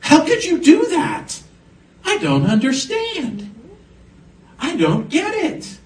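high mock bewilderment; baffled onlookers. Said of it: "Because they say".

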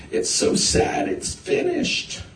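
put-on high, soft whimper of a little girl; mock-tearful, breathy little-girl voice; imitated crying child. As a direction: mimic a tearful little sister, airy and small. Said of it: "the little sister is crying".

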